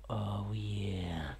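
soft, low whisper; whispered relish. Pretend. Streamer savours what viewers just saw, soft whisper. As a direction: drop to a hushed, drawn-out whisper. Mock-intimate aside after success.

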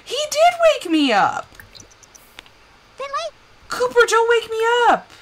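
high-pitched, loud scolding of a pet; exasperated high yell. Pretend. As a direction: yell it high, like you were just woken. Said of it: "I'm sorry I yelled at you".

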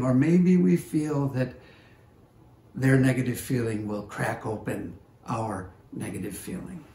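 ordinary delivery

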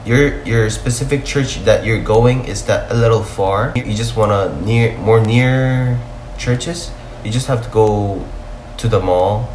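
ordinary delivery

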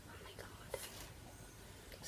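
a breathy whispered oh my god; awed whisper. Real, not acted. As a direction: barely voice it, lost in awe. Overwhelmed by a soft squishy.

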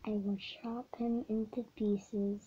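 soft whispered threat; imitating the villain's whispered threat; mock whispered threat.

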